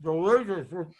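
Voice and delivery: talking with a mouthful of taco; chewing while talking; mouth full. Real, not acted. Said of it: "[name] is the one talking with his mouthful".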